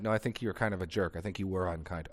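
low, soft acted complaint; quiet accusation. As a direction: voice a subdued objection, dropping away. Acting out someone's complaint.

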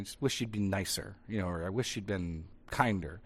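low, subdued mock grumble; soft complaint. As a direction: voice a quiet complaint under the breath. Acting out someone's mild complaint.

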